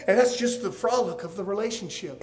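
ordinary talk, nothing marked

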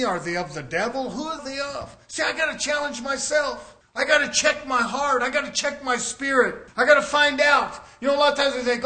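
ordinary talk, nothing marked

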